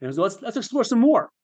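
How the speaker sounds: high, mock-cheerful prodding; sarcastic inner voice. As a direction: pitch it up, falsely eager. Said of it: "this incredibly sarcastic voice in my head".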